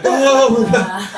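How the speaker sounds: slow, high, laughing gasp; amazed crowd. Retold or imitated. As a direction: marvel at the beating. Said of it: "we're like".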